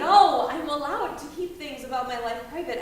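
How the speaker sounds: loud, indignant denial; defensive shout. Acted. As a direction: fire back at her. Teen pushes back in argument.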